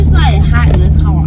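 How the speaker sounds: loud ratchet complaint. Pretend. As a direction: complain loudly to annoy him.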